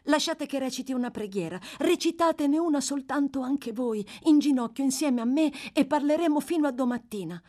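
put-on young boy begging to pray together; boy pleading. Oliver pleading in the cell.

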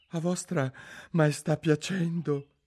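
high, slow, grovelling voice; extremely humble. A narrator's reading. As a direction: grovel before the queen, pitched high. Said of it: "con tono che più umile non si può". (tono umilissimo)

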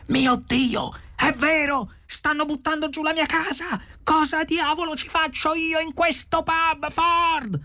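frantic, very high-pitched cry; horrified outburst. A narrator's reading. Arthur at the pub window sees the demolition, horrified outburst. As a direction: wail it in panic, pitch way up.

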